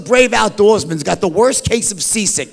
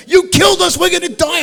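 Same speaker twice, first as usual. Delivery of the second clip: high, frantic pitch, imitating the wife; panicked wife; 9 semitones higher than usual. Wife, tied to the others in the storm, blames her husband in high panic. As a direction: mimic the terrified wife, voice up high.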